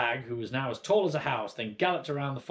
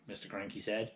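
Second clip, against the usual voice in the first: ordinary delivery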